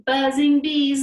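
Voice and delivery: loud words running into a long buzz; buzzing bee sound. Shown as a demonstration. Teacher cues kids' exhale, loud bee buzz. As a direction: hold the final z of bees as a buzz. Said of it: "make that buzzing bee sound out".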